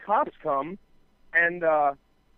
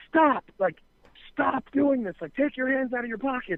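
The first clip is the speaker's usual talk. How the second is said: high, urgent imitated yelling; retold screaming; 6 semitones higher than usual. Tow truck driver ordering the kid to stop, imitated scream. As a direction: act out yelling a warning, pitched well up. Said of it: "Like, screaming at him".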